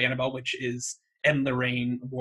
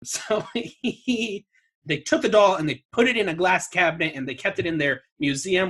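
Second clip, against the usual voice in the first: ordinary delivery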